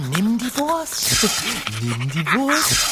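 slow, silly dog talk. Acted. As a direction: coax the dog slowly. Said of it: "so albern".

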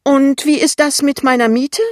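loud, high angry demand; suddenly furious. A narrator's reading. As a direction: flare up and demand it. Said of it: "fragt Frau Pinneberg plötzlich wütend".

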